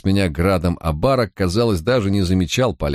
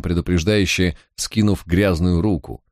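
plain reading voice

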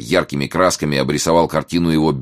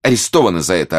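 higher, louder indignant threat; snapping back; 6 semitones higher, 5 dB louder than usual. A narrator's reading. Thrashed young reporter threatens Martin, snapping back. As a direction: snap it back, stung. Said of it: "огрызнулся тот".

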